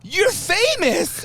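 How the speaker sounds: high, loud, stretched-out exclamation; going crazy. Retold or imitated. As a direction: gush like a starstruck fan. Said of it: "he was, like, going crazy".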